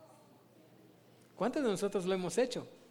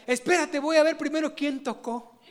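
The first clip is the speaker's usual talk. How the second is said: calling back; 6 semitones higher, 4 dB louder than usual. Acted. Sister calls back, punchline.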